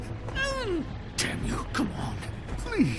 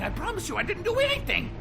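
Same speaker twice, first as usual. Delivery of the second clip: high, desperate protest; panicked denial; 9 semitones higher, faster than usual. Acted. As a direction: protest innocence, pitch climbing steeply. Caught, protesting innocence.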